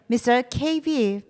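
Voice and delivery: usual speaking voice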